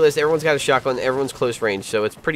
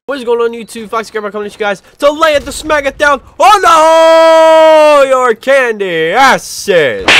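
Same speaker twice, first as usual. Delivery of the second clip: screaming wrestling intro, voice cracking high; wrestler promo voice cracking; 13 semitones higher, 11 dB louder than usual. Acted. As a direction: hype wrestler shout that breaks up. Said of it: "My voice cracked when I did that".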